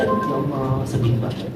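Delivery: soft, low-voiced sorrow; hushed sad sigh. Widow at the Ka'bah, quietly sad. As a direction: breathe it out quietly, grieving. Widow speaking beside him.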